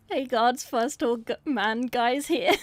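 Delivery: hammed-up intro impression; mock YouTuber intro. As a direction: ham up his intro, half-laughing. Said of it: "That's not what you sound like".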